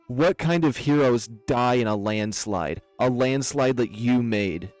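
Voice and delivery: loud, furious accusation; mounting rage. Acted. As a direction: confront the culprit with fury. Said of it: "as her rage overtook her".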